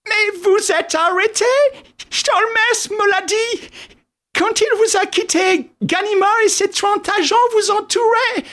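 very high squeaky flustered voice; high put-on voice, incredulous; Wilson, stammering. A narrator's reading. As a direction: squeal in astonished protest. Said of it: "Wilson bégaya en brandissant son unique main".

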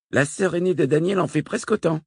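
voix d'enfant plus aiguë, un peu moqueuse; voix de fillette. A narrator's reading. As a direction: fille qui rabaisse l'exploit de sa mère. La fille commente le saut. (petite voix de fille, aiguë)

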